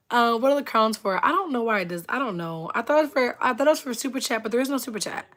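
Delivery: usual speaking voice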